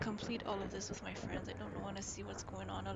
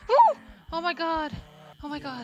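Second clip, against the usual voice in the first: shrieking with relief after the escape; screaming, just got away; being chased; 6 semitones higher, 17 dB louder than usual. Real, not acted.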